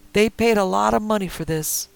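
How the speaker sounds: high, loud, rough threat; old man with shotgun warning the kid, loud; armed old man threatening. Acted. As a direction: bark it through the door. Marty through window.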